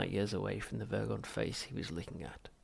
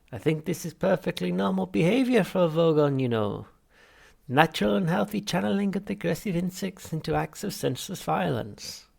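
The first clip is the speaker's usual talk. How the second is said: much higher, louder unctuous therapist; Halfrunt voice; 9 semitones higher, 8 dB louder than usual. Acted. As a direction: greet the patient with oily cheer. Psychiatrist voiced.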